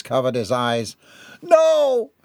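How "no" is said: high, loud, drawn-out scream. A narrator's reading. Gerard frozen on the spot before the attack, terrified scream. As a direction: scream it in terror. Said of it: "Gerard screamed".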